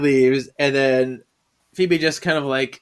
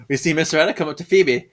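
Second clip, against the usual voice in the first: ordinary talk, nothing marked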